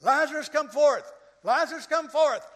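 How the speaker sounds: high loud call to the tomb; loud calling command; group shouting. Retold or imitated. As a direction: shout it out to raise the dead. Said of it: "were instructed to say".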